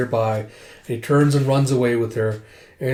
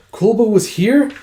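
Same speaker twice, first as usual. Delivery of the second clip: high, loud, rising disbelief; startled question; 8 semitones higher, 6 dB louder than usual. Acted. Princess hearing of an unexpected visitor, startled. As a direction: shoot the question up in surprise.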